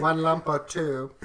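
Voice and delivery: offering sugar like a creepy British butler, mock British accent. Pretending to serve tea as a creepy Brit, British accent. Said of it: "my British guy's really creepy".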